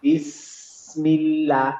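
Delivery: বিসমিল্লাহ with the mouth opened on বিস; very slowly stretch বিসমিল্লাহ, lips spread; open Seen. Shown as a demonstration. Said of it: "বিস বললাম তখন আমার মুখটা খুলে গেল".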